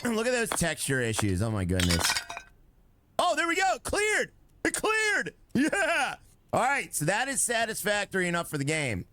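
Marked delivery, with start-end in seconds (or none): 3.2-6.1 excited. Real, not acted. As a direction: cheer as it finally clears. Tiles finally cleared.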